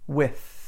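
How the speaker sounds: With ending on a breathy voiceless th, tongue on the teeth. Shown as a demonstration. Teacher contrasts the voiceless th in With for students. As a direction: end With on an unvoiced th. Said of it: "You hear how that's different?".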